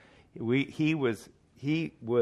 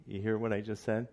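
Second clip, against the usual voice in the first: usual speaking voice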